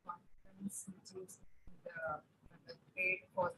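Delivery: faint, distant mic voice; speaking far from the microphone; barely audible. Real, not acted. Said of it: "but your voice is very slow".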